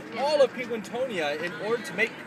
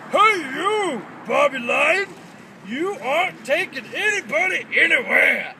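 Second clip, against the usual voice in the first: high, loud defiant shout; heroic penguin yell; 4 semitones higher, 6 dB louder than usual. Acted. Penguin hero yelling at the robot lion, defiant shout. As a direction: call out from the statue. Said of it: "yelled in the greatest penguin voice".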